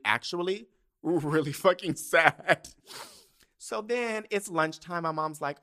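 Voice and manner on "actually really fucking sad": louder, higher and drawn out with a laugh; slow, emphatic confession breaking into laughter; laughing at own sadness. Real, not acted. Vlogger insisting the old heartbreak was real, laughing. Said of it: "I'm not being dramatic".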